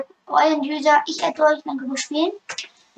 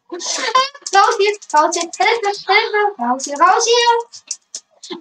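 panicked yelling; 5 semitones higher, 4 dB louder, much slower than usual. Pretend.

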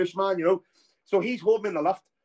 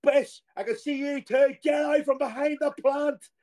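much higher, slowed calling out; mock shout; 8 semitones higher, slower than usual. Manager shouting at a player hiding behind a plant. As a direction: bellow across the lobby in jest. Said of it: "Harry was like".

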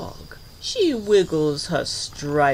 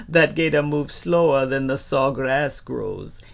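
ordinary delivery

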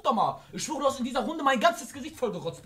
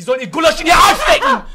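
loud, climbing yell; sudden shouted outburst; 5 semitones higher, 14 dB louder than usual. Real, not acted. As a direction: blow up, pitch rising to the end. Comic outburst about his grandma.

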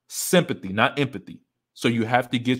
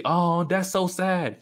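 high, slow, fake pity; mock sympathy; 7 semitones higher, much slower than usual. Passersby faking sympathy for a crying man, drawn-out and high. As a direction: draw it out like hollow sympathy.

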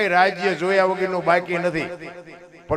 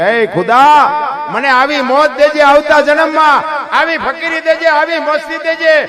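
high, loud cry to God; fervent prayer; 10 semitones higher, 11 dB louder than usual. Acted. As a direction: call out to God with all your heart. Sikandar praying to God.

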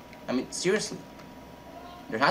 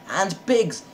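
thrilled yelp, higher and louder; excited cry; 9 semitones higher, 6 dB louder than usual. Real, not acted. Gamer spotting pigs, a real excited cry. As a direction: shout it out, delighted to spot them.

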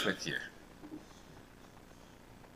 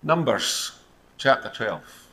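ordinary delivery

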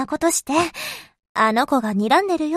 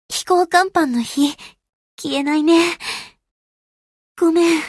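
fading apology. Acted. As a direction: apologise as your strength gives out. Sinking, deck fire will not go out.

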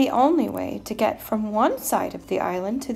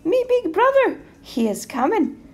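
high, loud little billy goat; 7 semitones higher, 7 dB louder than usual. A narrator's reading. Runt offering up big brother, high little-goat voice. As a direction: bargain high-pitched as the runt. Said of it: "in his little billy goat voice".

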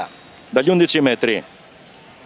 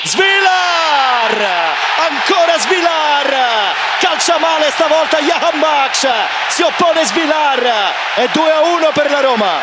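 breathless italian goal commentary; 7 semitones higher than usual. Real, not acted. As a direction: scream the save, drawn out. Second save called live.

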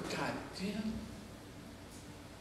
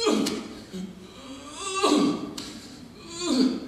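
mock groans on the stairs; 6 semitones higher, much slower than usual. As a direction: groan through each painful step. Struggling down the steps.

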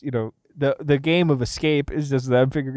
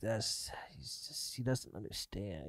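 hushed Jigsaw whisper; 3 semitones lower, 11 dB quieter than usual. Retold or imitated. Jigsaw dismissing his copycat, hushed whisper. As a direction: lean in and whisper the secret. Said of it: "Jigsaw is like".

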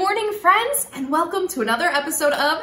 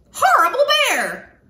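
much higher, louder squeal; squeaky shout; 10 semitones higher, 6 dB louder than usual. Acted. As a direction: squeak it out like an angry bat. Bat squeaks at the bear.

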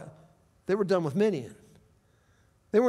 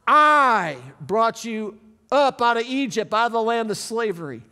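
preaching scripture aloud; 3 semitones higher, 6 dB louder than usual. Acted. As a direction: proclaim God's words to the congregation. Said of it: "Are you ready?".

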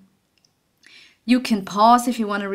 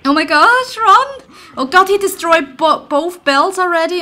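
higher and louder, frantic; panicked yelling; 7 semitones higher, 7 dB louder than usual. Real, not acted. Gamer yelling in panic as the monster wrecks the bells. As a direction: yell in panic while fleeing.

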